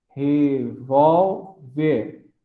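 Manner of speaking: "revólver" slowly split as re-vól-ver; syllable by syllable. Shown as a demonstration. Teacher dividing a word for class, "revólver" slowly in three separate syllables. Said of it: "a sílaba tônica é o vol".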